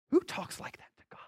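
breathy unvoiced aside; whisper. Real, not acted. Preacher whispers to congregation in mock shock. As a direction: hush it like a scandalized secret.

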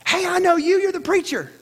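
lightly voiced excited fan at a party; imitating a fan. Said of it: "he goes".

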